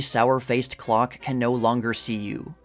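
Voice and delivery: normal talking voice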